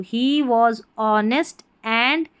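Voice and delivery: word by word, slow; slow reading. Shown as a demonstration. Teacher reading the sentence to learners, slow and clear. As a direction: read each word slowly. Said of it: "अब एक बार आपने यहाँ पर सीख लिया".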